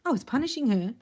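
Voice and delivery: dawning self-discovery, pitch lifted; sudden realization. Acted. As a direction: have it dawn on you mid-confession. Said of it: "this is what he's realizing".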